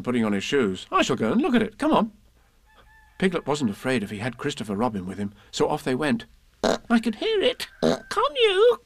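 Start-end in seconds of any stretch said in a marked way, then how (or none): 0.9-2.1 lightly voiced boy, pitched higher; decisive, in a higher voice; Christopher Robin. A narrator's reading. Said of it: "said Christopher Robin". 6.9-8.8 high, worried little squeak; anxious. A narrator's reading. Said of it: "said Piglet anxiously".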